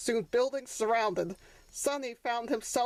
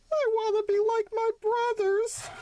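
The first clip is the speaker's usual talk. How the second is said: put-on high childlike voice, louder; wistful little donkey; 12 semitones higher, 4 dB louder than usual. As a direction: wish aloud to mother. Sonny wishing.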